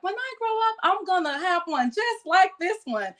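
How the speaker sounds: put-on little girl voice. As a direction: wish it out like a kid. Child self wishing.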